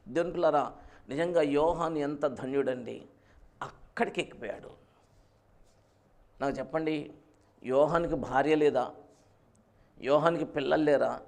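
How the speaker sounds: ordinary delivery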